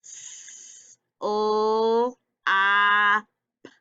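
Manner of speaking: S, L, A, P as separate held sounds; teacher segmenting S, L, A, P sound by sound. Shown as a demonstration. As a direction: stretch each sound of S-L-A-P with gaps between. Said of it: "One more time".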